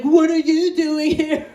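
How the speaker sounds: high, drawn-out question; loud mock surprise; surprised nurse. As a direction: stretch out the puzzled question. Acts surprised nurse greeting her.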